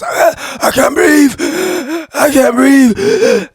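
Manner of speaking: imitating a choking man. Podcaster voicing a man pinned under a knee, choking. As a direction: gasp it out as if choking.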